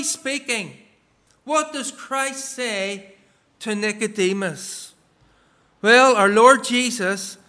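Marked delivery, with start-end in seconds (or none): none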